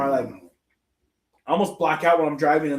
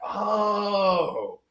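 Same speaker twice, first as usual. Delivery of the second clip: higher, drawn-out dawning realization; retold realization; 5 semitones higher than usual. Patient hearing the doctor's reason, retold realization. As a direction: let the penny drop, pitched up.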